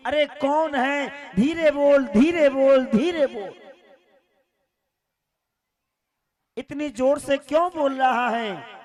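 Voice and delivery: Yashoda shushing, loud and high, half laughing; hushing mother. Acted. Mother hushes loud visitor.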